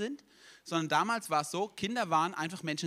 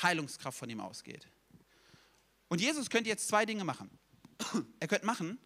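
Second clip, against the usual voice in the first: ordinary talk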